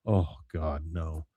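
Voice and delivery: acted dread. Hank realizes he must take the hit, low dread.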